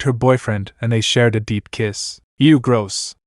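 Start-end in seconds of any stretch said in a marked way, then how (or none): none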